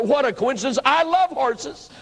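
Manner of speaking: exaggerated fake excitement; mock delight. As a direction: ham up the surprise so she's impressed. Feigning delight to win Peggy.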